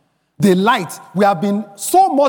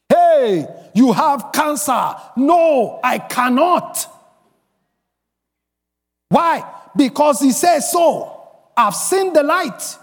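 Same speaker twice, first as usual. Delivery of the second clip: defiant declaration; 8 semitones higher, slower than usual. Acted. Preacher acts out refusing a cancer diagnosis, defiant declaration. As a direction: proclaim it high and slow, with total conviction.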